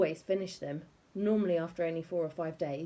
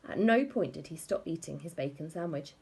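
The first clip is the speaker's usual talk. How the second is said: ordinary delivery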